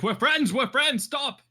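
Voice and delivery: high frantic yelling; screaming. Acted. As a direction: scream it, pleading. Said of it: "screaming in Draconic".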